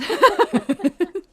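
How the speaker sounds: a big, bright burst of laughter; loud high laugh. Acted. Friend laughing at the twelfth emir, loud high laugh. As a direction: cackle at the joke.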